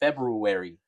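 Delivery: "February" with the first r sounded, Feb-roo-ary; "February" with both r's pronounced; spelling pronunciation. Shown as a demonstration. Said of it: "when I try to write it out".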